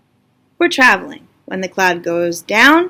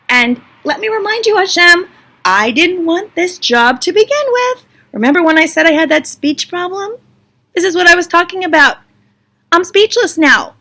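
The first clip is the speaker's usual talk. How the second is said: high-pitched, hammed-up grievance; exasperated protest; 6 semitones higher than usual. Moshe reminds Hashem he never wanted this job, exasperated. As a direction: plead your case, fed up.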